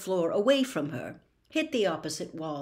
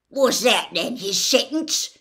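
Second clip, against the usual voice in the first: louder, angrier, slowing; rising anger; 4 semitones higher, 8 dB louder than usual. A narrator's reading. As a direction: let the anger climb. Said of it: "his voice rising angrily".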